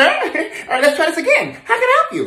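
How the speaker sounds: high, over-sweet restart; put-on helpful voice climbing high; forced service cheer. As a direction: reset to a high, polite voice. Resets to fake cheer after argument.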